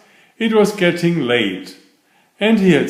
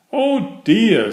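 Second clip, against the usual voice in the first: much higher mild "oh dear" of a small bear; a touch of bear dismay, pitched up; worried little bear; 8 semitones higher than usual. Acted. Paddington reacts to stuck children with a higher, mildly worried bear voice.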